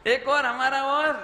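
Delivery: high, loud, rising repeat; loud mock eagerness. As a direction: throw it out high and loud, egging them on. Repeats the egging on, then asks why. (ऊँची आवाज़ में जोशीली नकल)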